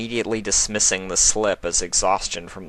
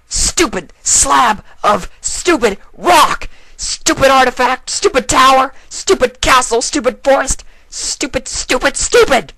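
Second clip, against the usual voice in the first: much higher, much louder tantrum of screams; furious screaming; 9 dB louder than usual. Acted. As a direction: scream each insult at the rock in a rage. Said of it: "she screamed".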